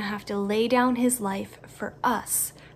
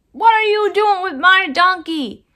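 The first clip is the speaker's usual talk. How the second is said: higher, louder demanding question; alarmed owner; 8 semitones higher, 9 dB louder than usual. Acted. Owner catching friends untying his donkey, loud alarmed demand. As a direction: challenge them as the upset owner.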